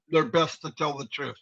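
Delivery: ordinary talk, nothing marked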